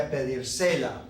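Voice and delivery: "pedírsela" wrongly stressed on "se", pedir-SE-la; misplaced stress. Shown as a demonstration. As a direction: let the stress slide onto the "se" in "pedírsela". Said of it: "we don't like the sound of that".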